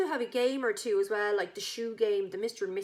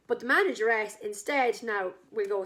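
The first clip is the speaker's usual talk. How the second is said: ordinary talk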